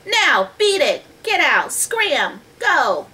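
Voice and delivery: yelling. A narrator's reading. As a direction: shoo the creature off loudly, word by word. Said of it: "Jack yelled at it".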